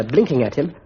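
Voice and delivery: ordinary delivery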